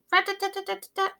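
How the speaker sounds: high, fast rat-tat-tat; woodpecker tapping. Acted. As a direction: rattle it off quick like a little hammer. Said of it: "sounds like a little hammer".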